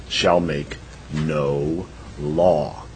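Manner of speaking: very slow and soft, word by word; professor's emphatic repetition. Retold or imitated. Law professor repeating the amendment to students, drawn-out emphasis. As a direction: draw out each word, leaving space between them. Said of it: "And then he says it again".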